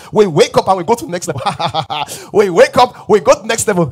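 loud, high, driving sermon delivery; forceful preaching. Real, not acted. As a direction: drive the point home at full voice.

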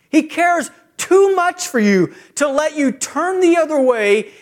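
high, loud, slow preaching climax; preaching at full force. Acted. Preacher pleading with the church about God's love, at full force. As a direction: pour it out over the congregation, rising and slowing.

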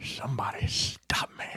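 slow whispered movie-star impression; hushed, drawn-out catchphrase, played for laughs; Jim Carrey. Joking that the comedian plays the villain, whispered impression. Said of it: "Jim Carrey's playing Bane".

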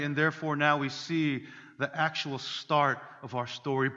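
usual speaking voice

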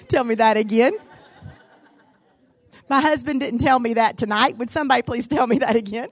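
loud, high, laughing banter; delighted laughing joke to the room; laughing, playful. Real, not acted. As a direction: tease the crowd through laughter.

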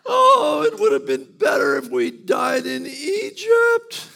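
loud, high, hammed-up wailing complaint; drama queen wail. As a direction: overact a weeping whiner. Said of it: "they go full-on drama queen".

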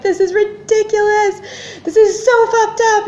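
high-pitched exasperated outburst; acting out a wailing, outraged complaint; screaming. Patient venting at her partner over the phone, screaming. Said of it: "but I'm screaming at her".

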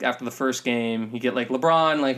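normal talking voice

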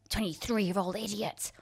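contained, contemptuous mock telling-off; imitated anger. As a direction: imitate an angry director's insult. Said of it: "with anger".

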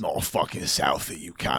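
London tough guy. Pretend. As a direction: curse them as a low-voiced Londoner. Dad impression answering the mock accent.

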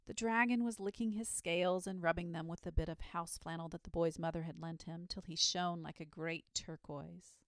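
ordinary delivery

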